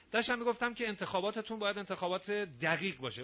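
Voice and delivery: normal talking voice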